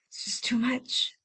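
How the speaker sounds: soft whisper, worn out; exhausted whisper; whispered overwhelm. Retold or imitated. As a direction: breathe it out like it's all too much. Overwhelm voiced.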